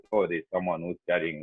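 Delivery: ordinary delivery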